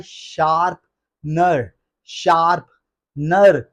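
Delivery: SHARP-ner, slow with a break after शार्प in शार्पनर; correct pronunciation. Shown as a demonstration. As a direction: spell out शार्पनर slowly as two parts, shaarp, nar. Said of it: "आपने उनसे कहना है". (शार्पनर को धीरे, शार्प और नर में तोड़कर)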